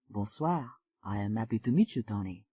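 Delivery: a smooth Frenchman greeting her; French accent. Acted. French man in chat.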